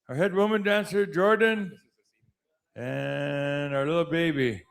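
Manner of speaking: announcer introducing names; powwow emcee. Acted. Introducing committee members.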